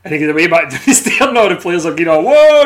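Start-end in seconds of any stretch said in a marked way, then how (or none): 2.1-2.6 high, loud mock gasp of the teammates; players' shocked whoa. Teammates watching Gaza sent off, loud and high whoa. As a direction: yelp it like the squad just saw a bust-up. Players reacting to Gaza's exit.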